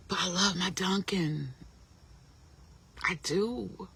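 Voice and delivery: soft whispered admission. Real, not acted. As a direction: breathe it as a secret. Whispered confession.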